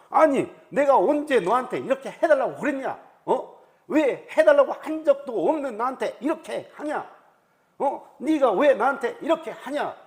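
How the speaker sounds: loud, high, acted confrontation. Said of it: "이런 식으로 따지기 바쁘다는 거예요". (언성을 높여 다그치듯 따지기)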